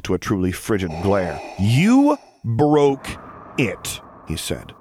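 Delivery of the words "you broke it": higher, loud and pointed on every word; each word jabbed. A narrator's reading. Luke jabs a finger at Vader, stressing each word. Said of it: "accentuating each word with a pointed jab".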